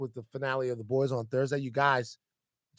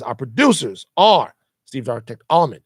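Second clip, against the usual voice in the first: ordinary delivery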